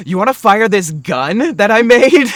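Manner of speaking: eager, loud and high; excited invite. Retold or imitated. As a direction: pitch it up, all eager. Excited quote of own character.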